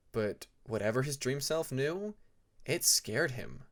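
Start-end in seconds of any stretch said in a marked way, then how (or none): none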